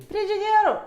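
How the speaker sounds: high, excited shout of the word; excited exclaim. Real, not acted. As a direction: announce it with delight. Excited reveal of fridge.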